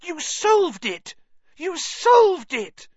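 high, loud, drawn-out scream; thrilled high-pitched shriek; excited screaming. A narrator's reading. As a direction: scream it joyfully at a friend.

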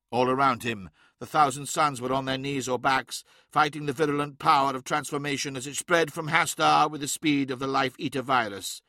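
ordinary delivery

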